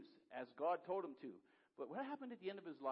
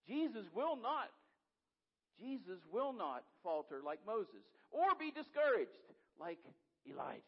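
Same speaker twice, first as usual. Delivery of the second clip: much higher and louder, climbing to a cry; impassioned preaching; 6 semitones higher, 6 dB louder than usual. Acted. Pastor telling the congregation of Elijah's despair, impassioned crescendo. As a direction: preach it like the building is on fire.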